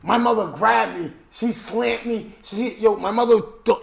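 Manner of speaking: ordinary talk, nothing marked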